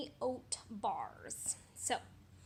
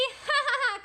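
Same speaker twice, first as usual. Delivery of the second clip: high, quick cackle; pig's mocking laugh; 13 semitones higher, 11 dB louder than usual. Pig laughing at the tricked wolf, high cackle. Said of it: "cried the little pig".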